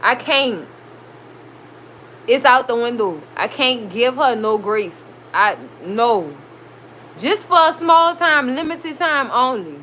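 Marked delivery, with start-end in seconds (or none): none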